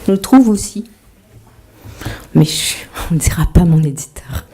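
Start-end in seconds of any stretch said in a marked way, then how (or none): 2.3-4.4 unvoiced conspiratorial whisper; whisper aside. Real, not acted. Author whispering a joke about her publisher. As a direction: let the audience in on a secret.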